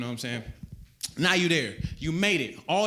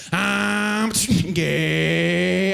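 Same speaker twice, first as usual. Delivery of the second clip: Prius engine voice; 3 semitones higher, 9 dB louder than usual. Retold or imitated. Comic voicing the Prius as it speeds away, loud mock car voice. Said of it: "'cause I heard".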